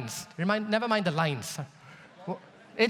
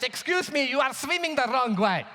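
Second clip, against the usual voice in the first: imitated stern objection, pitched up; higher-voiced mock telling-off; German man's reprimand; 5 semitones higher than usual. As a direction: correct a swimmer as the indignant German man. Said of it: "German man stands up".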